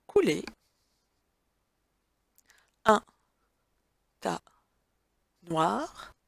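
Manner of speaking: word-by-word dictation, long pauses; dictating. Shown as a demonstration. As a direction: space out each word for writing. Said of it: "dictée read word by word".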